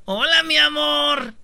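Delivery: high, drawn-out teasing hello; singsong mocking hello; mock sweet greeting. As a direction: stretch it out high, mimicking the mistress. Acts mistress greeting the husband.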